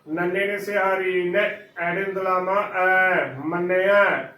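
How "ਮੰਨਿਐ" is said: ਮੰਨਿਆ louder, as "manne-ai" with open falling end; correct open ending. Shown as a demonstration. Teacher models correct reading, ਮੰਨਿਆ ending in open "ai". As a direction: finish firmly on a long "ai", no "ee". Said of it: "ਮੰਨਿਆ ਉਚਾਰਨ ਕਰਨਾ ਹੈ".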